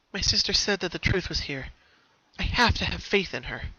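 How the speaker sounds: lightly voiced pony steadying herself; Pinky talking herself into courage. Pinky to herself in the dark hallway, nervous resolve.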